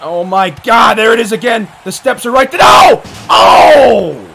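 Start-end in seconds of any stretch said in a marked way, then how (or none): 2.6-4.3 loud whooping "oh" at impact; big wincing roar; shocked yells. Real, not acted. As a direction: holler as the blow lands. Reacts to a hard hit.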